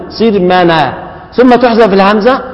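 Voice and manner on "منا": منا with the hamza's fatha moved onto the nun; naql demo. Shown as a demonstration. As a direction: say منا, nun carrying the vowel. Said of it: "نضع الفتحة التي على الهمزة إلى النون".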